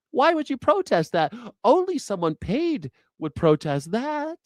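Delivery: high, sing-song sarcastic voice; mock-innocent sarcasm about protesters. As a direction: parody the officials' naive disbelief. Sarcastic impression of officials.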